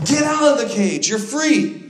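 high, full-force preacher's cry; triumphant shout. Acted. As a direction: shout it out as a liberating command. Preacher voices God at climax.

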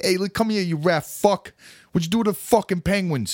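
tough old school New York accent, hammed up. Hippie girl confronting him, in a New York accent. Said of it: "old school New York accent".

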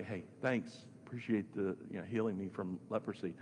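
offhand, low and soft, quickly tossed off; mock casual thanks. Healed leper just going back to Jesus, mock casual thanks. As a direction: imitate someone thanking far too casually. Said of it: "He didn't just go back and say".

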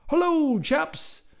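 high, hearty British greeting; hearty Englishman. Acted. Englishman welcoming newcomers in the warehouse, hearty British voice. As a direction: greet them like a jolly English gent. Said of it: "intoned the hearty Englishman".

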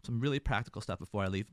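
ordinary delivery

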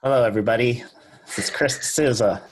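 mock radio host greeting, projected. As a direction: put on your best radio presenter voice. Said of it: "use your best radio voice".

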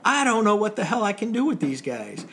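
mock angry officer. Warrant officer scolding the Coast Guard students, loud gruff rant. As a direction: bark out the officer's disgust. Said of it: "And he goes".

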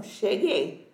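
"Cheguei" dropped low in pitch; low, understated "Cheguei"; message read with an intonation. Shown as a demonstration. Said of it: "Eu li com essa entonação".

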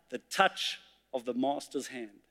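a quiet, awed answer; hushed reply. Acted. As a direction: drop to a hushed reveal. Reply revealing the master's touch.

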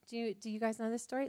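normal talking voice

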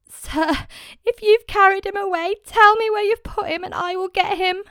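Mary begging the man she takes for the gardener; desperate plea. Acted. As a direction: plead with him to give the body back.

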